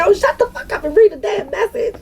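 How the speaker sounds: high, squeaky laugh-through telling-off; squealed through laughter; laughing quote. Retold or imitated. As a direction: retell a scolding while cracking up. Quotes cussing while cracking up.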